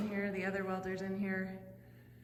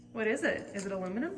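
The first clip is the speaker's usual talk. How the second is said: ordinary talk, nothing marked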